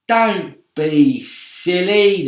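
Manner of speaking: very slow loud high scoff; centipede scoffing. Acted. Centipede mocking the earthworm, slow loud scornful voice. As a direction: drawl it scornfully. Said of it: "the centipede told him".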